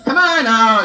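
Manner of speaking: loud, laughing call; hammed-up urging shout; mock coaxing shout. Christ coaxing Moses, shouted.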